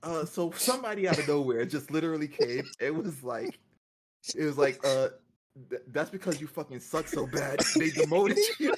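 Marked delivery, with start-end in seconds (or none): 4.8-8.8 quoted jab dissolving into laughter at the end; retold insult, cracking up. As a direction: start evenly, then break into laughter.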